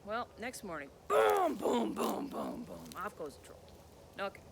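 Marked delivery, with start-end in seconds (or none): 1.1-1.9 booming, louder stomp sounds; giant footsteps. Acted. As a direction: stomp out the troll's footfalls. Troll's footsteps leaving.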